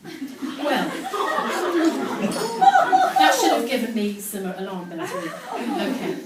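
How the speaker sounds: ordinary talk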